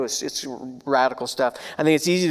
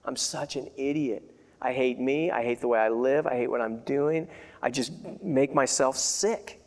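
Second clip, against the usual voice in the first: mild retold despair; 5 semitones lower, 6 dB quieter than usual. Preacher recalling his nights as a young man, softer and mildly tearful. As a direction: recall the self-loathing gently, not full crying. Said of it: "I remember crying myself to sleep".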